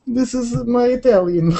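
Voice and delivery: high-pitched cartoon Italian accent; hammed-up Italian accent. Streamer answers teasing about sounding Italian, high mock Italian accent. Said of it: "You talk like an Italian mobster".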